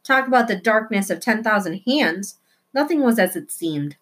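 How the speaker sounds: plain reading voice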